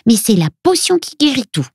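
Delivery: put-on high-pitched child voice; Luna. A narrator's reading. Little girl presents mother's potion, high child voice. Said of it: "continue Luna".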